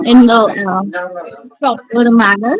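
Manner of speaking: normal talking voice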